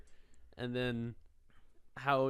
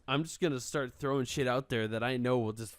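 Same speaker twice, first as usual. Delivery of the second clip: usual speaking voice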